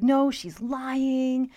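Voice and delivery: imitating a cheater's panicked denial; mock defensive boyfriend. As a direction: play the lying boyfriend protesting too much. Boyfriend's lying denial acted out.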